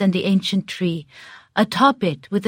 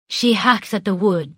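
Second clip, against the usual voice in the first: normal talking voice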